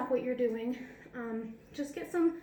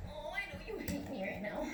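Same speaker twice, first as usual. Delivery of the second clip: soothing; 3 semitones higher, 6 dB quieter than usual. Real, not acted. Soothing her unhappy dog.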